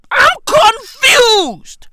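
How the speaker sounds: loud, high, stretched-out yell; high slow shout. Acted. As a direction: wail it out slowly and loud. Comic rant, shouted high.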